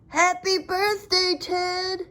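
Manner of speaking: a hint of a cheerful grandmother calling. A narrator's reading. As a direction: greet him from across the garden. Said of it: "she called".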